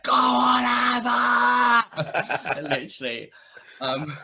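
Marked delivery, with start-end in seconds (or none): 0.0-2.6 loud, high cheering shout; mum shouting. Retold or imitated. Mum cheering her daughter on at the wrestling, loud shout. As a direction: yell it like mum at ringside. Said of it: "she's literally like".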